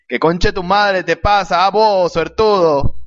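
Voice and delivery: hammed-up slow indignation; angry papá. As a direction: stretch out the curse as a comic angry dad. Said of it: "el papá le dice".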